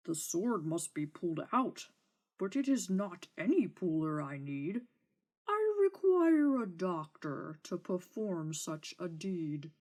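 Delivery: lightly voiced dragon; a hint of a lofty beast giving orders. Dragon to the knight, mildly voiced demand.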